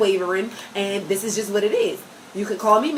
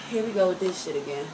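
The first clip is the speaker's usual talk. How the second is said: low, softer retold aside; muttering to oneself; 4 semitones lower, 7 dB quieter than usual. Parent privately grumbling about a crying child, muttered low to oneself. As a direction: say it under your voice, to yourself. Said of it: "I be saying it to myself".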